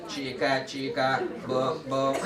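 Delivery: low, monotone robot chant. Shown as a demonstration. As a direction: say it evenly like a robot. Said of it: "say it like a robot".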